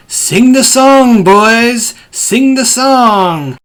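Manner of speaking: loud, high, drawn-out holler; imitated yell. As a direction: holler it slowly like rallying a chorus. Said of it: "he's yelling".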